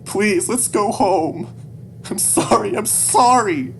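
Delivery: high, loud, desperate apology; sobbing plea. Acted. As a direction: beg through sobs, very high and loud. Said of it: "sobbing on his pant leg".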